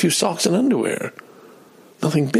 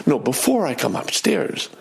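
usual speaking voice

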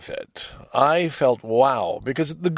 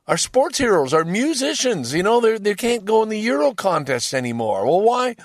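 higher and louder, fed-up ordinary Russian; a lightly voiced angry Russian man asking why; aggrieved Russian citizen; 9 semitones higher, 5 dB louder than usual. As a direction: complain like a citizen hurt by sanctions.

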